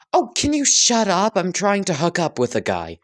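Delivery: mother snapping at her daughter to shut up; annoyed. Acted. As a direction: brush her off, irritated. Mother snaps at noisy daughter.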